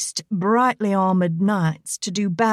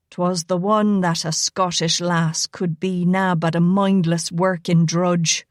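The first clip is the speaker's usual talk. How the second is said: Irish brogue; 2 semitones lower than usual. Acted. Rigo baiting Elena with a joke, impish Irish brogue. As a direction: lead her on with a sly Irish lilt. Rigo's teasing rumour.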